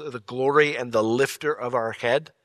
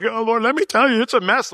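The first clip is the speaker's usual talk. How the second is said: overwhelmed complaining; 11 semitones higher, 6 dB louder than usual. Acted. Will acting himself venting.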